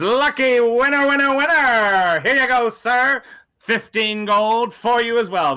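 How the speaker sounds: high, loud, stretched carnival-barker call; game host announcing. Acted. Host paying out the gambling winner, loud drawn-out announcing. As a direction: sing out the win like a table host. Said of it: "So he turns and goes".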